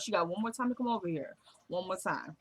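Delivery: soft, low-key imitated aside; muttering to herself. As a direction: retell it as a private grumble under the breath. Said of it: "She said it to herself".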